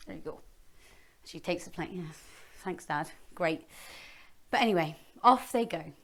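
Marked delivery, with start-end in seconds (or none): none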